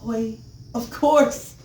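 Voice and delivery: slow, high, loud burst of recognition; delighted realization. Retold or imitated. Father recognizes his daughter in the dream, amazed. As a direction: let it dawn on you, then burst out. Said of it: "And I was just like".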